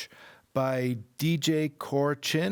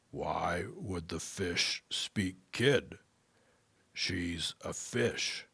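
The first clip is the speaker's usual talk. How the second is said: slow, softer wise tree voice; tree gently reasoning with the boy, slowly; 4 semitones lower, 6 dB quieter, slower than usual. Acted. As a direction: speak slowly like an ancient tree.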